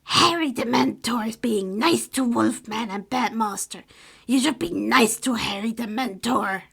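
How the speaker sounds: elf voice, stern warning; creaky elf scolding the man; warning house elf. Acted. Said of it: "Creature warned with narrowed eyes".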